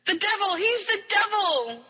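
high-pitched retold cry of alarm; mock yell. River reacting to Harry Potter's name, mock yell. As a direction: imitate a panicked yell without full volume. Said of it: "yells River".

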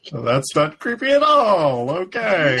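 loud, high, drawn-out sarcasm; sarcastic. Real, not acted. Reacting to a creepy line in the story, sarcastic. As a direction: stretch it out in exaggerated mock reassurance.

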